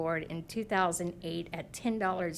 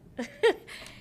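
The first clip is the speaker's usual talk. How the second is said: nervous laugh; 7 semitones higher, 3 dB louder than usual. Real, not acted. Nervous speaker pauses mid-speech.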